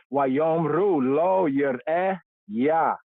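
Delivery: Wayomru lo yireh Yah read slowly, each word clear; slow careful Hebrew. Shown as a demonstration. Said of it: "reads Hebrew phrase slowly".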